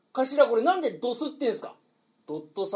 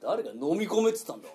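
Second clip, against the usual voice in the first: ordinary talk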